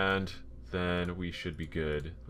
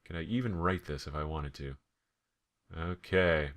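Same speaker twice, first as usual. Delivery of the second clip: ordinary talk, nothing marked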